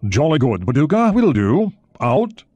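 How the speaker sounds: put-on jolly British English accent signing off, rising; English accent over the radio. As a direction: keep up the English accent, cheerful sign-off. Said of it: "He was speaking in an English accent".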